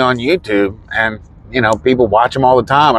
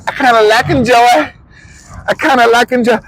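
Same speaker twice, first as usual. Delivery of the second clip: toying with the phrase in a raised voice; playful higher repeat; 10 semitones higher than usual. Shown as a demonstration. Repeating his own phrase for fun, raised and playful. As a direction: sing it up high like a silly echo. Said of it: "I don't know what the hell I'm saying".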